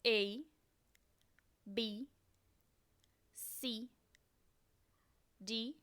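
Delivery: English letter names, spaced out for repeating; slow model reading of the English alphabet; slow alphabet dictation. Shown as a demonstration. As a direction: read each letter slowly with long gaps for the learner. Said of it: "vou ler de uma forma um pouco mais devagar".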